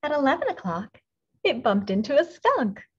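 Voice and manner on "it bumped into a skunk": ordinary delivery, nothing marked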